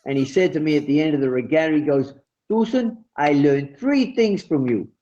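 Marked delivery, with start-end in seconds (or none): 2.5-4.9 put-on French accent. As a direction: imitate a French sailor speaking English. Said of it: "a, a French accent".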